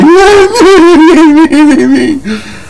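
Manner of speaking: long, high mock squeal. Vlogger mocking wrestler's head shake, long high squeal.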